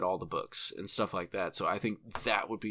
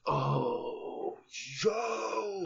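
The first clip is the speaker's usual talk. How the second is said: excited yo; 12 semitones higher than usual. Real, not acted. Excited reaction to Guillermo del Toro idea.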